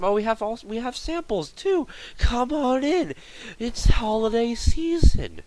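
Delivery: high, put-on salesman pitch; eager sales rep. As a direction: coax shoppers in an exaggerated high voice. Voicing the eager sales representative.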